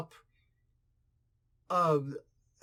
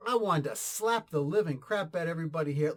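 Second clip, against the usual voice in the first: usual speaking voice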